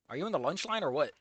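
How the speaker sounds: ordinary delivery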